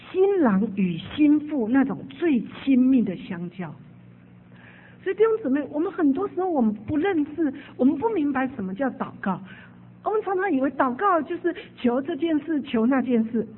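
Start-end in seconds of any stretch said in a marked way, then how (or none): 5.0-13.5 fervent preaching. Acted. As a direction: press the point home to the brothers and sisters. Preacher exhorts congregation.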